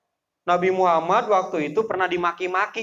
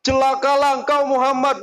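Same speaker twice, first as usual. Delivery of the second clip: higher, louder cursing uncle; uncle's curse; 8 semitones higher, 4 dB louder than usual. Retold or imitated. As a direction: hurl the curse at your nephew. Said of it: "Ini paman yang ngomong". (paman mengutuk keponakan dengan marah)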